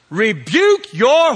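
high, loud, drawn-out command; loud rebuke. Acted. Pharisees ordering Jesus to silence his followers, loud rebuke. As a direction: thunder it slowly like an offended Pharisee. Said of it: "They said to Jesus".

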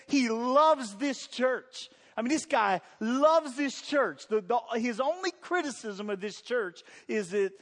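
loud, high preaching at full force; fiery preaching. Acted. Preacher driving his point home to the congregation, loud and fiery.

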